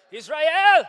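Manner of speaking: high, loud rallying shout. Acted. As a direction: bellow it like a rally cry. Joke chant at race.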